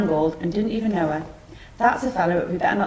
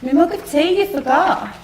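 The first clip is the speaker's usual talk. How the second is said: high-pitched whining reminder; high whiny complaint; 7 semitones higher, 4 dB louder than usual. Acted. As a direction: whine it up high. Mother complains in a whine.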